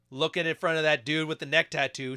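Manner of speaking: usual speaking voice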